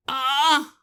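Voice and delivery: sudden high loud cry of Ah; startled yelp of relief; a squealed Ah; exclaiming. Acted. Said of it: "Nancy exclaims".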